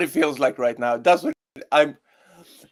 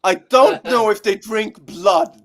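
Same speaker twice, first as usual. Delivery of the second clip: slow, disbelieving, leaning on don't; slow mocking disbelief; incredulous repeat; 4 semitones higher, 5 dB louder, slower than usual. Shown as a demonstration. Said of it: "Did they actually say".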